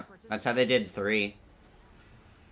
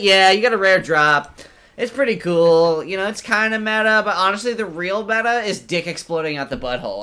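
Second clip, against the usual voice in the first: loud, raised voice of an eager explainer; hammed-up enthusiasm; 9 semitones higher, 9 dB louder than usual. Game explaining a rare drop to the player, hammed-up enthusiasm. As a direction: sell the absurd loot with mock excitement.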